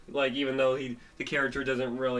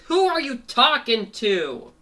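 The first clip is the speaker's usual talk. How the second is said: loud, high, drawn-out challenge; indignant demand; 10 semitones higher, 9 dB louder than usual. Acted. Rabbit challenging Fango mid-fight, indignant demand. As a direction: call out the question in mock indignation.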